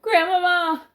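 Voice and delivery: high loud cry of Grandmama; shouted call. Acted. Frightened boy shouting down to his grandmother, high loud call. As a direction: yell Grandmama up high to reach below. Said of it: "I shouted".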